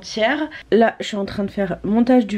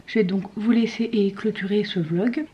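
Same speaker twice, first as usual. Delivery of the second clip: ordinary delivery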